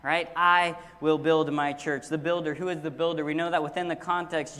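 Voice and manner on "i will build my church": loud, stretched "I" opening a slow phrase; stress on I. Shown as a demonstration. Preacher pointing to the church's builder, stress on "I". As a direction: punch "I", then deliver the rest deliberately. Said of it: "We will emphasize a different word".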